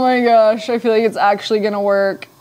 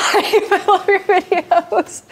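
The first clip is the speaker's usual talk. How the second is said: laughing; 9 semitones higher, much slower than usual. Real, not acted. Reading chat, laughing.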